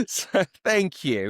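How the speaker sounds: laughing thank you; slow thanks through a laugh. Real, not acted. Laughing thanks for jawline compliment.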